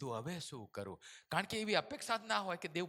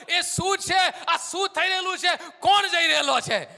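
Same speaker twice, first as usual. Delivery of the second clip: loud, high, hurried questions; urgent asking; 13 semitones higher, 12 dB louder than usual. Acted. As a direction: demand to know what is happening. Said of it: "એને જલ્દીથી કહ્યું".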